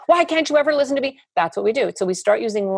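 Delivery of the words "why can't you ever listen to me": high, fast mock outburst; rapid, high-pitched pleading complaint; exasperated mother. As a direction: burst out like a parent at wit's end. Acted exasperated mother to child.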